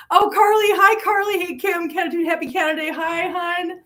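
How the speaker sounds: excited hellos to arriving viewers; excited greeting. Real, not acted. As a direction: sing out each name.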